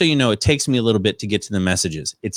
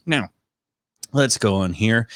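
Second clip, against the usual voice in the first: ordinary talk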